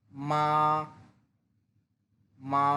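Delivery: মা held level and stretched long, no rise or fall; flat drawn-out মা; Mandarin first tone. Shown as a demonstration. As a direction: sing মা on one flat, steady pitch. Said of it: "আপনি normal শব্দটাকে একটু টেনে লম্বা করবেন".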